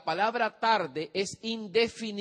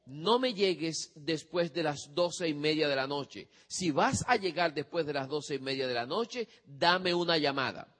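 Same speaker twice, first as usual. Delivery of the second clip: the right way, clear terms. Shown as a demonstration. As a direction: spell out the deal calmly to a teenager. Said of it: "Dígale a él".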